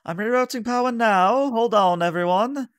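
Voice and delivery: high, loud ship's officer calling out; Varissa. Acted. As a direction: call it out urgently to the whole crew. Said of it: "Varissa kinda calls it and says".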